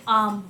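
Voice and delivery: आम with long stressed आ. Shown as a demonstration. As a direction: lean on the आ in आम. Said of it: "आ है उस पे ज़्यादा बल दिया".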